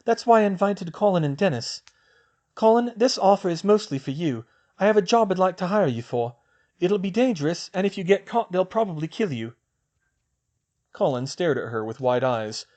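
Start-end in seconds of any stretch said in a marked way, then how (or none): 0.0-9.6 put-on higher young woman's voice, brisk; girl's voice. Hermione planning with Luna, in a higher girl's voice. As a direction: voice the girl, a clear step up in pitch. Female character voiced high.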